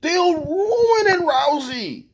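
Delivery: high, slow dismayed whine; high slow mock despair; disbelief. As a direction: plead like your favourite is being ruined. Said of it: "I'm like".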